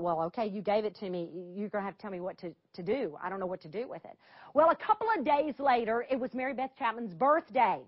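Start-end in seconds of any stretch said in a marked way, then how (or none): 0.0-4.2 hushed baffled prayer. Retold or imitated. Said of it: "I thought".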